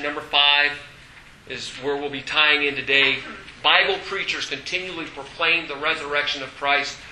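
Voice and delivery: ordinary talk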